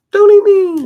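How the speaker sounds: very high, loud squeak; squeaky voice. Pretend. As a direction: squeal it like a tiny creature. Squeaky plea of mock fear.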